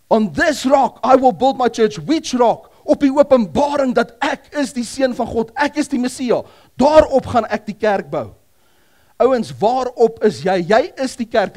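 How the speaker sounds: loud, high, fervent sermon delivery; loud preacher voice; preaching at full force. Acted. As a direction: proclaim it to the whole congregation.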